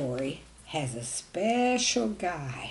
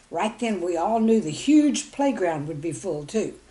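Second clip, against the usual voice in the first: usual speaking voice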